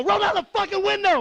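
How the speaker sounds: high-pitched mock yell; exasperated cop's order. Cop ordering a giggling driver, high exasperated mock yell.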